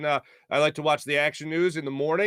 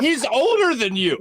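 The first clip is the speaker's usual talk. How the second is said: much higher, louder, slow comeback; mock-outraged correction to his little cousin; incredulous retort; 10 semitones higher, 5 dB louder, slower than usual. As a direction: set the young cousin straight. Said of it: "I go".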